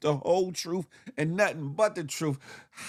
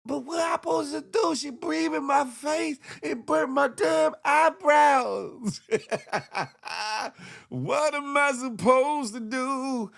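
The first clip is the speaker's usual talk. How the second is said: put-on pleading kid; 7 semitones higher than usual. Mock plea as the boy.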